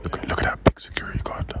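breathy hushed whisper. Real, not acted. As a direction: whisper near a film set. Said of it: "I fully have to whisper".

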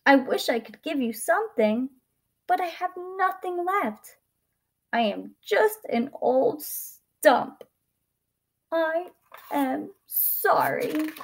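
high voice slowing into an apology; regretful tree. A narrator's reading. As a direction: draw out the last words with sorrow.

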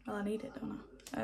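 quick unvoiced whisper to herself; hushed, rushed aside. Real, not acted. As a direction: breathe it out as a fast private aside. Muttered aside about needing tan.

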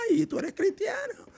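high, mocking taunt; mocking neighbor. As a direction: sneer like a jeering neighbor.

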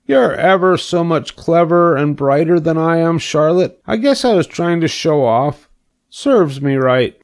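high, plaintive pig voice; crestfallen pig. A narrator's reading. Pig concedes to the spider, crestfallen. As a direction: own up as a humbled little pig.

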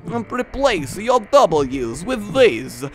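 put-on Transylvanian accent. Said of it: "That's accent corner".

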